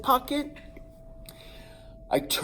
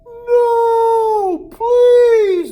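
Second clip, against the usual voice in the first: much higher, much louder pleading cry; desperate scream; 13 dB louder than usual. Retold or imitated. The boy screams as the rooster claws his head. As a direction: scream for mercy. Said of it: "I'm like".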